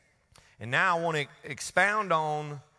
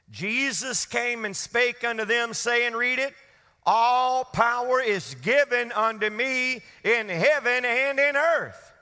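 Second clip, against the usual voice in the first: raised, loud preacher's proclamation; forceful scripture reading; 6 semitones higher, 4 dB louder, slower than usual. Acted. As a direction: proclaim the verse, stretching it out. Reads scripture at full force.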